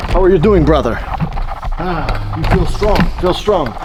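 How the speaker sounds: hearty mock Dagestani wrestler's hello; Dagestani accent. Cormier walking up to people, in a mock Dagestani accent. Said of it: "He puts on the Dagestani accent".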